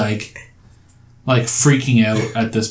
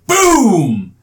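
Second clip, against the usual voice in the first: mock explosion; 9 semitones higher, 4 dB louder than usual. A narrator's reading. GM narrates the explosion.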